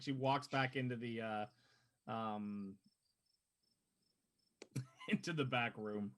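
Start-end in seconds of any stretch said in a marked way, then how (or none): none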